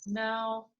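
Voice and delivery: ordinary delivery, nothing marked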